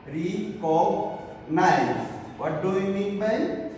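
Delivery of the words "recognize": recognize drawn out slowly in separate syllables. Shown as a demonstration. Teacher sounding out a word for students, slowly syllable by syllable.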